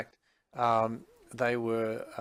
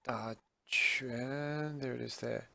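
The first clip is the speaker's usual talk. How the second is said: "Da quan" whispered, quan stressed; whispered model; 6 dB quieter, slower than usual. Shown as a demonstration. Teacher repeats the word for students in a whisper. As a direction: breathe "Da quan" softly to the class. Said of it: "Da quan is to box".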